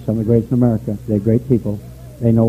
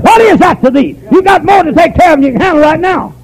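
high, loud, preached rebuke as Jesus; voicing Jesus; 7 dB louder than usual. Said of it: "Jesus said".

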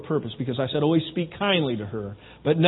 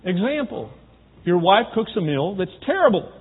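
ordinary talk, nothing marked

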